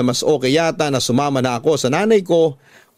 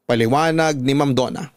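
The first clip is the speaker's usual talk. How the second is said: plain reading voice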